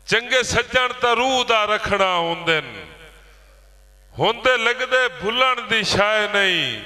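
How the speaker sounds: ringing, rhythmic verse declamation; poetry recital. Acted. Poet recites to a village gathering, declaimed verse. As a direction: declaim line by line, falling on each rhyme.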